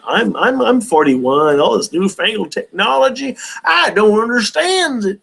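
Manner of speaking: lightly voiced grumpy old man. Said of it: "I do a better old man voice than that".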